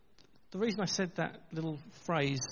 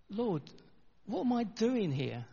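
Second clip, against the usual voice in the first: higher, drawn-out East End plea; East End accent; 5 semitones higher, slower than usual. Retold or imitated. Church leader sends up an arrow prayer, East End accent. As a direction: imitate a doubting East Ender, pitched up. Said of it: "In his East End accent".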